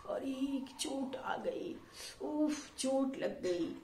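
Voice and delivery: acted hurt, high and whispery at first; sorrow, pain. Shown as a demonstration. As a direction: demonstrate pain with a slow, hushed wince. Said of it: "या अपने दुख को".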